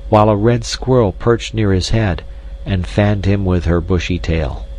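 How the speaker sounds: ordinary delivery, nothing marked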